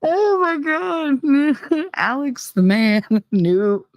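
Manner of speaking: high, squeaky, slowed by real laughter; helpless laughing wheeze on the words; laughing hard. Real, not acted. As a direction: barely get the words out through laughter. Cracking up while trying to talk.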